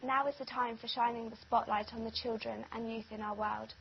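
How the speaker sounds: normal talking voice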